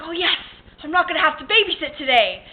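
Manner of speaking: 높고 크고 빠르게 기뻐하며; relieved celebration. Retold or imitated. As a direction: cheer at being let off babysitting, loud and high. Acting out her relief at waking.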